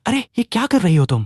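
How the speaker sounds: flustered, high-pitched question. A narrator's reading. Boy to fox girl stroking him, flustered. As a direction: ask it in a nervous fluster. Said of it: "मैंने घबरा कर पूछा".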